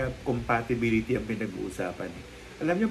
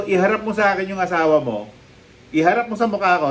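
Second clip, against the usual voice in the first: defiant retort; 5 semitones higher, 6 dB louder than usual. Retold or imitated. Confronting angry architect on phone.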